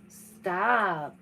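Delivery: ordinary talk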